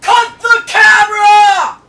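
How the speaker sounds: high yelled order; shouting. Real, not acted. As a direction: yell it out loud. Shouting at crew.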